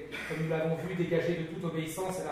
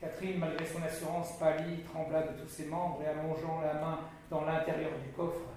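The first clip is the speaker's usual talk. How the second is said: ordinary delivery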